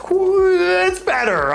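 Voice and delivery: "better" stretched out slow and high; drawn-out emphasis. Real, not acted. Praising the old WWE Network to listeners, stretched high. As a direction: lean hard on "better" to sell it.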